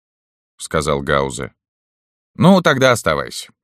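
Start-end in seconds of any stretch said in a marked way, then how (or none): 2.4-3.5 high, louder colonel; sneering colonel, raised. A narrator's reading. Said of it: "полковник".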